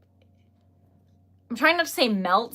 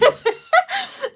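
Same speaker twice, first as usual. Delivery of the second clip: high burst of giggling laughter; giggle; 11 dB louder than usual. Real, not acted. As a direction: break into a squealing giggle. Giggling at own joke.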